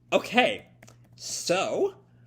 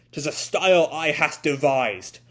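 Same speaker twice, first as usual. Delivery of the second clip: louder, archaic, proud samurai boast; proud swordsman; 6 dB louder than usual. Acted. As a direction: declare your own technique with pride. Voicing Suzaku's archaic boast.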